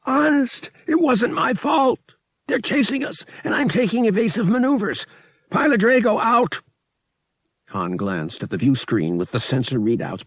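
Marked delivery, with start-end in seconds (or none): 0.0-6.6 high, loud, frantic radio call; pleading over the comm, straining. A narrator's reading. Han insists to the colony it wasn't his fault, strained shouting. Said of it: "Han's voice cracked from the strain".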